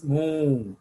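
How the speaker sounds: lightly imitated cow moo; a mild, word-like moo; cow's moo. Shown as a demonstration. Teacher showing animal sounds, lightly imitated moo. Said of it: "these are the sounds of the animals".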